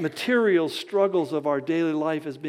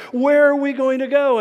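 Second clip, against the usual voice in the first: panicked cry; 7 semitones higher, 6 dB louder than usual. Acted. People trapped at the sea.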